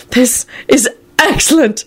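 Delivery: high, breathless wheeze of glee; wheezing. A narrator's reading. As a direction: wheeze it out with delight. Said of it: "George wheezed".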